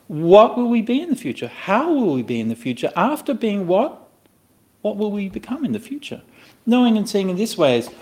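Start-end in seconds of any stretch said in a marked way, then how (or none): 0.1-6.2 lightly acted wondering, mostly higher pitch; acted pondering. Teacher voicing doubts about the future, wondering. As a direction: voice a seeker's questions in rising and falling swoops. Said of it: "would you think this".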